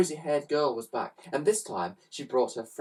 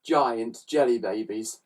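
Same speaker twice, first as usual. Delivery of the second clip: usual speaking voice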